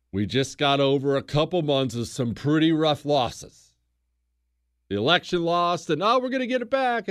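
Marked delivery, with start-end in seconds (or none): 6.0-7.1 high-pitched, quick burst of optimism; imitating hopeful supporters; mock hopeful. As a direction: act out eager hope, fast and pitched up. Acts out post-election hopes.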